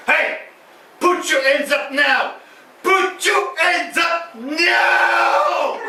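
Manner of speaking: long drawn-out American movie-cop shout; version originale américaine. Shown as a demonstration. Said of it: "la version originale américaine, ça donnerait ça". (cri de film américain, très étiré)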